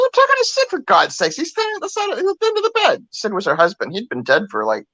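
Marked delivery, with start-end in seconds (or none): 0.0-3.1 put-on much higher, impatient old lady; exasperated grandmother. Grandmother insisting to her son, higher and impatient. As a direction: play the cranky grandma. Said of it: "She goes".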